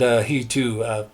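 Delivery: usual speaking voice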